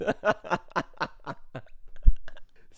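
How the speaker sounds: loud, breathless real laugh. Real, not acted. Laughing at his own dine and dash story, loud real laughter. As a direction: burst out laughing.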